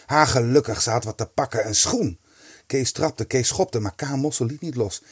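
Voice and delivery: usual speaking voice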